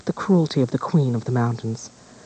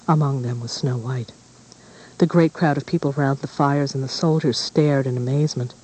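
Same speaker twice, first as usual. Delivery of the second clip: usual speaking voice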